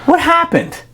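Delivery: high-pitched bewildered question; shocked. Retold or imitated. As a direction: act out total disbelief. Retold shock at seeing captain.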